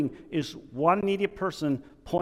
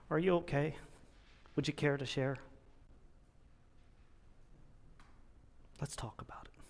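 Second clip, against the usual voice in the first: gentle, caring bear; 13 dB quieter, faster than usual. Retold or imitated. Caring bear climbs down beside him.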